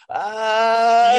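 high, loud gasp of amazement, imitating friends; awed gasp. Friends in the hot tub seeing the ship, high awed gasp. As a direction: mimic the non-believers' shocked "Ah". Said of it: "And they're like".

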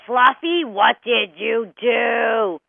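loud, drawn-out scolding of a pet; accusing owner scolding the dog; angry yell. Acted. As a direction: yell slowly, stretching each word. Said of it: "you come home and you yell".

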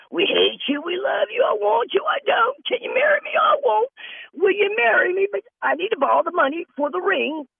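very high, wailing mock suitor; mock scammer lover. Mocking an online scammer with a shrill pleading voice. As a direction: ham up a needy scammer's pleas.

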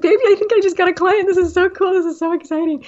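bursting with excitement. Retold or imitated. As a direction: gush to the husband about the first client. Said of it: "I was so excited".

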